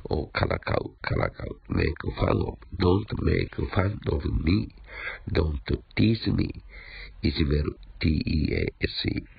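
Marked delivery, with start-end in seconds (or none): 2.8-6.6 slow model sentences. Shown as a demonstration. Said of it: "teacher models the sentences slowly".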